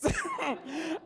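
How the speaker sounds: high little giggle; amused giggle at oneself; laughing. Real, not acted. Laughs at own naivety.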